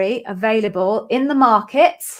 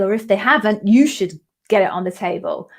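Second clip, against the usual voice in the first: ordinary delivery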